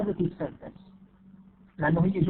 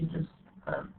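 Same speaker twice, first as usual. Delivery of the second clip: normal talking voice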